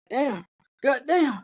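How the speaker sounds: exaggerated hurt disbelief; voice of a feeling. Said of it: "they can fucking say some shit that you're like".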